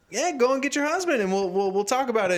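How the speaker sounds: high, knowing tease, as if he already knows; knowing, teasing Jesus. Retold or imitated. Jesus teasing the woman at the well, knowing and high. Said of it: "so he was saying that kinda like".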